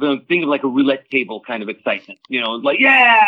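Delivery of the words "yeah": loud, high crowd whoop; excited cheer. Retold or imitated. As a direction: cheer like the crowd at a roulette table. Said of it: "everyone's screaming and yelling in a positive".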